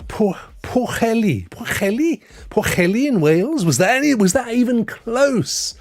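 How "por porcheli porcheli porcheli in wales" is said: Porcheli said with a throaty ch, then asked as a question; trying Welsh pronunciation. Shown as a demonstration. Streamer guessing a Welsh name, asking chat. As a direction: guess at Porcheli, rising unsure. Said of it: "How do I pronounce this?".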